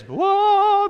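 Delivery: startled exclamation; surprised whoa. Real, not acted. Surprised by light.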